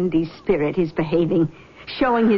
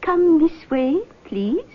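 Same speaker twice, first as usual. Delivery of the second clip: lightly voiced mystic, higher pitch; seance medium; 8 semitones higher, slower than usual. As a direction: greet them like a gracious clairvoyant. Medium leads girl to room.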